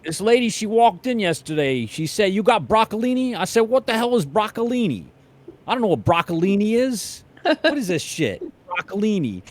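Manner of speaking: loud, high Brooklyn counter guy; exasperated New York deli man; Brooklyn Italian accent. Retold or imitated. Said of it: "And he's like".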